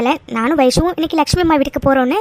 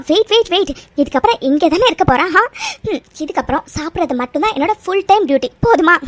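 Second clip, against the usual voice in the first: usual speaking voice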